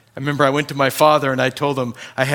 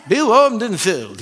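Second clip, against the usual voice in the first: mock cheerful send-off; 8 semitones higher, 6 dB louder than usual.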